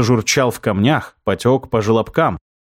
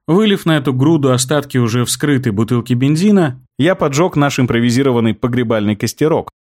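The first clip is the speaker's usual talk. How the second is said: ordinary delivery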